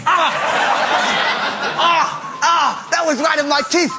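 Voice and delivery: ordinary delivery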